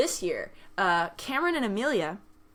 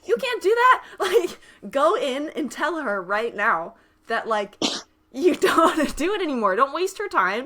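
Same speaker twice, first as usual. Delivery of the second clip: high, loud teasing dad voice; bossy playful push through laughs; mock dad advice; 6 semitones higher, 6 dB louder than usual. Dad urging her to quit now, high loud teasing voice. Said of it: "but he's like".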